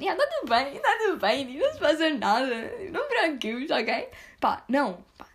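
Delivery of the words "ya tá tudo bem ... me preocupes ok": high, put-on fine voice insisting all is well; fake reassurance. To friends asking if she's ok, a high fake-cheerful voice. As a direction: brush it off brightly, pretending nothing's wrong. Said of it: "e depois eu digo mesmo".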